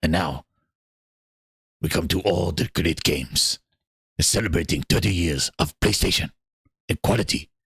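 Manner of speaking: low, deep voice of a showcase host giving a tour; mock showcase host. Streamer playing the showcase host, giving a kitchen tour in a deep voice. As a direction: play the smug host showing off the kitchen.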